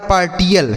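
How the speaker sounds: "पार्टियल" with a hard T; beginner's mispronunciation. Shown as a demonstration. Teacher quoting a new student's misreading, "पार्टियल" with T. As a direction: say "पार्टियल" as spelt, T sounded. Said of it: "कोई नया बच्चा होता है ना तो इसे पढ़ता है".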